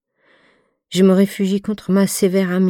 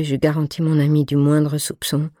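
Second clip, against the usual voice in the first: plain reading voice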